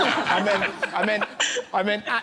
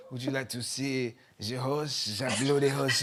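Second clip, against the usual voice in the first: put-on low French-accented seduction; mock French accent; 5 semitones lower, 4 dB quieter than usual. Host imagines the French teacher showing the lung, mock French accent. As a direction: tease it out in a lowered French accent. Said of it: "there's sort of an exoticism to it".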